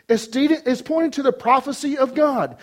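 loud, high, ringing proclamation; full-force preaching. Real, not acted. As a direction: drive it home to the whole congregation.

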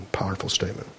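whispered aside. Real, not acted. Aside during scripture reading.

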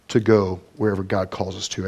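usual speaking voice

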